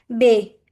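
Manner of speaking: बे said loud as a model ay glide. Shown as a demonstration. Teacher calling out the example word for the class, loud. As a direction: call out बे clearly. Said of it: "example देखें".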